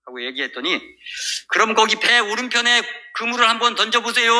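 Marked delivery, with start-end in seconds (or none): 1.5-4.3 high, sustained call to a boat. Retold or imitated. Man on shore tells fishermen where to cast, calling out. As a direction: imitate shouting instructions from afar.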